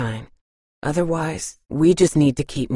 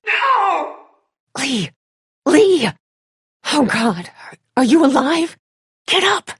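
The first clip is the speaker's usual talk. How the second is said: high, loud cries of alarm; panicked calling; 12 semitones higher, 6 dB louder than usual. Acted. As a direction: call out to a fallen friend. Lee falls, friends call down.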